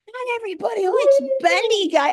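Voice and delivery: high, loud, hammed-up wail of pity; milk it like a tragic sigh; big mock lament. Shown as a demonstration. Speaker cueing the audience, a big mock lament. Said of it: "Give me a big".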